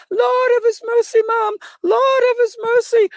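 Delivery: very high Jamaican nanny impression; hammed-up, high-pitched Jamaican accent; old Jamaican woman. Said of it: "Verge is an old Jamaican woman".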